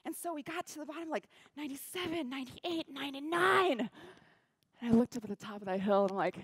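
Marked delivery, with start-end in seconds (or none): 1.6-3.9 rallying count, last one shouted; excited countdown. Retold or imitated. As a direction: build each number toward the climax.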